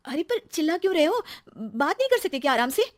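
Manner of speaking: high-pitched, offended wife; upset protest. Acted. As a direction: object to being yelled at. Wife protests his shouting. (आहत पत्नी का विरोध)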